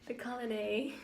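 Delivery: accidental British accent on colony. Real, not acted. Reader trying to copy her prompter, still in a British accent. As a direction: say colony British-style, ending in "ay". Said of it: "I keep coming in with a British accent".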